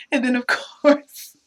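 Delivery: ordinary talk, nothing marked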